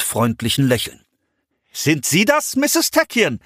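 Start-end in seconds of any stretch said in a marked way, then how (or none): 1.7-3.4 high, strained friendly call. A narrator's reading. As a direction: call across the rubble, forced cheer. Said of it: "rief er".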